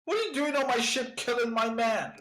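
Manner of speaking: a furious captain confronting boarders; outraged captain. Acted. Captain demanding answers from the pirate crew, outraged.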